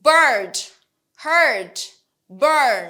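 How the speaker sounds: American BIRD, HEARD, BURN with sounded r; loud, clear American r-coloured vowel; American accent. Shown as a demonstration. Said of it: "American অ্যাকসেন্টে বলি".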